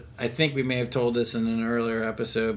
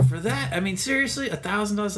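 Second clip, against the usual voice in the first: much higher, incredulous; mock outrage; 9 semitones higher, faster than usual. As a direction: play the stunned customer, pitched way up. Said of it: "And I was like".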